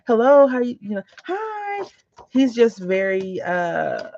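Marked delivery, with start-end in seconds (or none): 0.1-1.9 put-on cheery child greeting. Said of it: "He'll be like".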